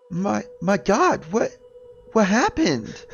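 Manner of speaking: high, loud, frightened wife's voice; terrified wife asking what happened; panicked. Acted. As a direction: stammer it out in alarm.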